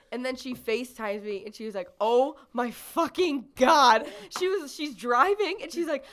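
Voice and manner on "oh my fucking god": retold horror. Friend retells caller's horrified reaction.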